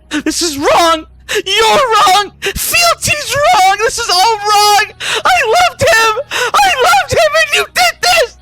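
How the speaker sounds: very high, sobbing screams of hate; tearful yelling. Acted. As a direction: scream accusations through grief. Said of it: "yelling this".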